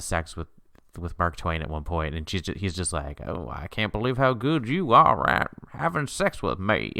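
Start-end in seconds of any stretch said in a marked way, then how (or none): 3.3-6.9 lightly mocking a bad Missouri accent; a hint of a bad Missouri accent. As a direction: parody the actor's clumsy Missouri accent. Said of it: "that's how good he sounds".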